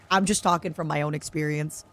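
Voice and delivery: ordinary talk, nothing marked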